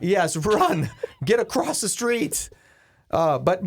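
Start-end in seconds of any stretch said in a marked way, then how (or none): 0.0-2.5 high, playful call across a road; mock urgent calling. Host joins in urging the runner across the street, mock calling out. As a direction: holler it as joking encouragement.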